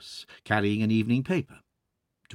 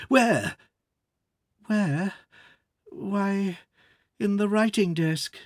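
higher, lightly voiced young woman; young daughter voice; 8 semitones higher than usual. Daughter answering her frantic father, high voice.

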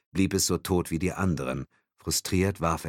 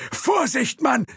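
high, loud, crowing cry; crowing warning; 6 dB louder, slower than usual. A narrator's reading. Thompson crows a warning at the driver, high and loud. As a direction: crow it out at the driver. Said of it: "krähte Thompson".

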